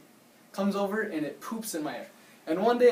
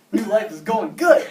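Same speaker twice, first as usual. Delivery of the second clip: laughing; 3 semitones higher, 9 dB louder than usual. Retold or imitated. Gloating over hot girlfriend.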